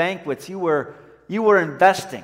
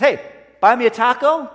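loud, raised-pitch request to a passer-by; imitated calling out; 5 semitones higher, 6 dB louder than usual. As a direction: play a stranger hollering for a favor. Stranger calls out to passing woman.

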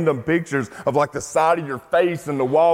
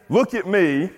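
raised-pitch imitation of a show-off; mock attention-seeking; 4 semitones higher, slower than usual. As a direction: call for notice in a higher voice. Mimics attention-seeking Paul never did.

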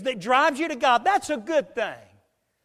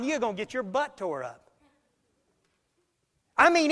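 normal talking voice

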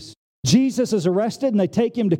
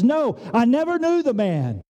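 emphatic denial; 6 semitones higher than usual. Acted. Preacher acting Peter denying Jesus a third time, emphatic denial. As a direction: deny it with rising exasperation.

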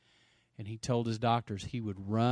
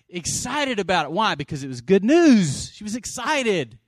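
raised voice, "good news" punched loud; excited preaching; 8 semitones higher, 12 dB louder than usual. Real, not acted. Preacher explains why she told everyone, excited preaching. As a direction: build to a loud burst on news.

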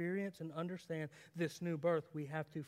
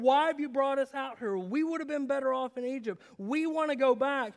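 louder whining protest of the wandering crowd; mock grumbling complaint; 8 semitones higher, 11 dB louder than usual. The Israelites grumbling at Moses in the wilderness, loud and whiny. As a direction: complain like an ungrateful people.

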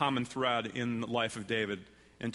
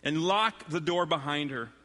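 slow, higher-pitched, punched-out command; demanding; 7 semitones higher, 4 dB louder, slower than usual. A narrator's reading. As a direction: read it as a forceful order to a servant. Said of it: "He shouted for his servant and demanded".

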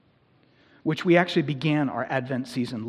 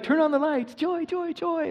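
much higher, sing-song mock-festive cheer; 13 semitones higher than usual. Pastor mocks the season's demand for joy, high and sing-song. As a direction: parody the forced holiday cheer. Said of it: "then it's like".

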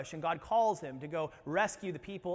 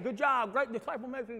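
higher, muffled cheering through food; mouth full; 5 semitones higher than usual. Pretend. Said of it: "with your mouth full".

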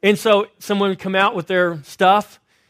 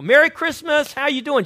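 bright, higher and louder holiday greeting; real cheerful; 6 semitones higher, 5 dB louder than usual. Retold or imitated. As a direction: greet her with cheer, pitch up. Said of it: "I'm real cheerful".

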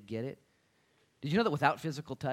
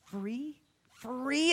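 high, rising disbelief; acted astonishment at a gift; amazed poor person; 13 semitones higher, much slower than usual. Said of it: "Now the poor".

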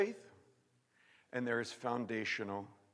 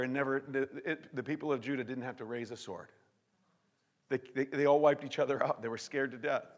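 usual speaking voice

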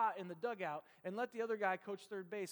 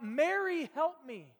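a desperate plea for help, called out with some force; mock cry to Mary for help; 5 semitones higher, 8 dB louder, slower than usual. Preacher voices Luther crying out to Mary for help. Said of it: "his first instinct was to cry out".

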